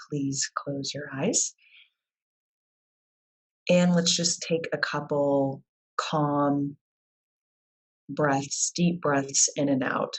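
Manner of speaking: unhurried guided-visualization voice with long pauses; guided meditation. Real, not acted. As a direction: lead them calmly through it, leaving space between phrases. Leads a guided visualization exercise.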